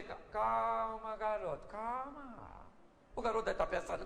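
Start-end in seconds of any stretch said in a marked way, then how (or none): 0.0-2.3 devagar e baixinho, arrastando as palavras; calma tranquilizadora. Acted. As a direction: acalme o garoto nervoso, sem pressa. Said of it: "O garoto nervoso, tremendo, Eliseu saiu". (tranquilizando, com voz mansa e lenta)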